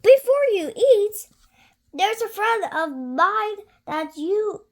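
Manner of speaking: high, loud squeaky cry; mouse voice. Acted. Mouse stopping the child, high and loud. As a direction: stop them with a squeak.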